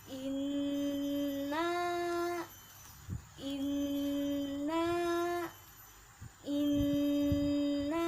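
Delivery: inna with the doubled n pressed and hummed, repeated; pressed nasal inna. Shown as a demonstration. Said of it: "Coba kita baca bersama-sama".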